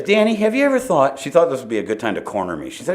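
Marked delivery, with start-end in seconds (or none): 0.0-1.2 lightly voiced nurse, pitched up; a hint of a sweet nurse; high mock nurse voice.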